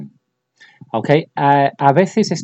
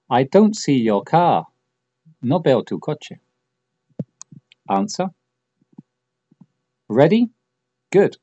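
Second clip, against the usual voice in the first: ordinary talk, nothing marked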